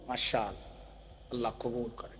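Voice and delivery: very low, hushed pious voice; mock pious murmur. Acted pious welcome to prayer. (ধার্মিকের নকলে খুব নিচু, মৃদু গলায়)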